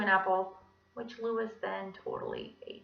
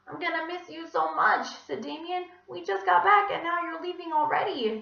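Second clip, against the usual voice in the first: put-on sad kid voice; 8 semitones higher, 6 dB louder than usual.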